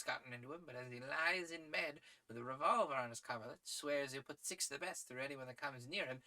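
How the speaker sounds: animated gentleman spinning a lively yarn; excitable English adventurer telling of a friend; sporting English adventurer. Acted. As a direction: voice a hearty sportsman, pitch often leaping up. Voices a story's gentleman sportsman.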